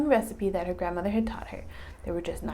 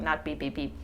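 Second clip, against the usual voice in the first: ordinary delivery, nothing marked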